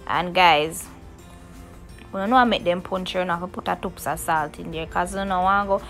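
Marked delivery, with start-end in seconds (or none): none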